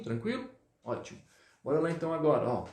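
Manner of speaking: usual speaking voice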